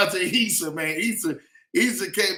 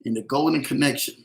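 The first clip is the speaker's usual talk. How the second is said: preaching; 4 semitones lower than usual. Acted. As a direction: preach it like a promise over the crowd. Livestream preaching to viewers.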